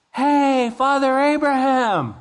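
high, loud desperate call across the gulf; rich man calling out. Retold or imitated. Rich man in flames calling loudly to Abraham. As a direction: cry out across the gulf for help.

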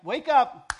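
loud high wake up; shout. Retold or imitated. Preacher rousing sleepy listeners, sharp loud shout. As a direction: bark it out to rouse them. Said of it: "and it's like".

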